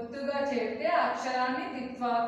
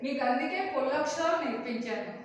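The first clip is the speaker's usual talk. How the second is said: ordinary delivery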